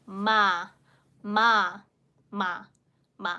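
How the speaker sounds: ma with a humming nasal m; 把“ma”发成带鼻音的m. Shown as a demonstration. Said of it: "you will need the nasal sound".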